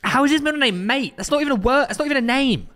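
high-pitched disbelief; incredulous. Real, not acted. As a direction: protest in baffled amazement, pitch up. Baffled by the middle name.

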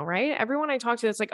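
normal talking voice

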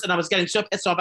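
normal talking voice

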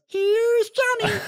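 high, slow drawn-out horror-movie line; Jack Nicholson. Retold or imitated. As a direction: stretch it out creepy and high. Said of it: "Jack Nicholson head ... says".

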